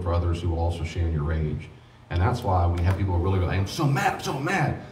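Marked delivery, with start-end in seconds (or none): 3.6-4.8 mock angry whine. Quoting angry grieving person.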